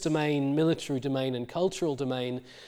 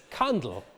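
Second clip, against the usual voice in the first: candle with CAN stressed; stress on first syllable; 2 semitones lower than usual. Shown as a demonstration. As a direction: put the weight on the start of candle. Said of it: "stress shift to the can syllable".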